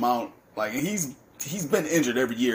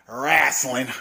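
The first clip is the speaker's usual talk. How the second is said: louder, punchy announcer delivery of Wrestling; hyped-up emphasis; 5 dB louder than usual. Shown as a demonstration. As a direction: sell the word Wrestling with hype. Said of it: "I gotta say that again".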